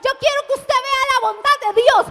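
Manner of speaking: loud, high, driving proclamation; full-force preaching. Real, not acted. Pastor declares God's greatness to the congregation, impassioned preaching. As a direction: preach it to the whole crowd at the top of your voice.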